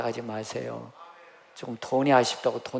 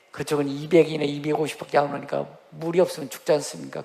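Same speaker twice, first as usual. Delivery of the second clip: usual speaking voice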